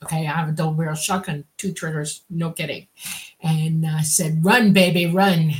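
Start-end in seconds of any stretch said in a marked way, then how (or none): 4.4-5.5 louder mock yell; yelled warning. Homeowner yells at intruders to run, louder. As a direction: bark it at the fleeing intruders.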